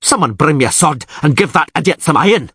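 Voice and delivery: angry ranting. Acted. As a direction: rage at the rival suitor. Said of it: "Krach an Kreit yelled".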